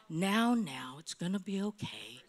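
soft, low, soothing hush; calm her like a child; gentle reassuring murmur; tender comfort. Retold or imitated. God comforting her in prayer.

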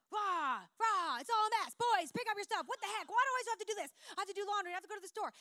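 loud, high, frazzled mom yelling; stressed mom ranting at the boys, loud and high. Retold or imitated. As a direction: rant at the mess in mock fury. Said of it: "and I'm like".

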